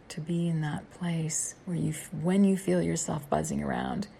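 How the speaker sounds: ordinary talk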